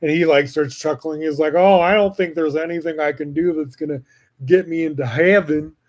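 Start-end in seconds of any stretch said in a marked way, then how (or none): 1.5-5.8 put-on a light, half-hearted stab at Trump's accent; slightly higher, mocking Trump's way of talking; mild Trump impression. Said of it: "that's not a good accent".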